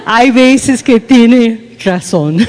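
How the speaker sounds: slow and laughing through the words. Real, not acted. Wife laughingly admitting her husband is sometimes right, slow and laughing. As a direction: concede it while giggling.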